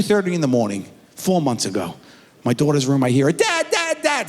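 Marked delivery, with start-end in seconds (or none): 3.3-4.3 high-pitched imitation of a panicked child; mock frantic. Daughter calling her dad at night, imitated frantic cry. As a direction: cry out urgently like a frightened daughter.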